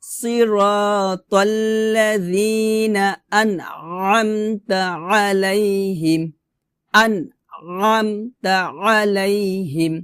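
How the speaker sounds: slow "সিরাতাল্লাযিনা" with dh and long vowels; pressed ayn in "আনআমতা" and "আলাইহিম"; tajweed recitation. Shown as a demonstration. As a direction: recite "আনআমতা আলাইহিম" slowly from a squeezed throat. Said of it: "আমার সাথে বলেন".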